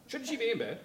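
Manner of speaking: high, fussy mother's question; fussing mother. Retold or imitated. Son imitating his mother fussing at granddaughter, high fussy voice. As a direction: pitch it up like a fretting mum.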